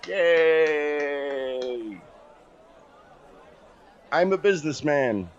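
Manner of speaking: a goblin selling himself with a bright cheer; cheerful goblin. Acted.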